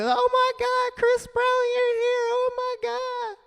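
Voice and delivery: very high, squealing excited women; excited ladies. Retold or imitated. Shelter women greeting [name], squealing with excitement. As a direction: squeal like starstruck fans. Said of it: "All the ladies be happy and say".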